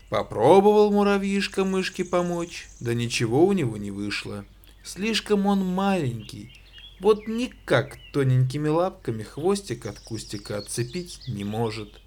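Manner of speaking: plain reading voice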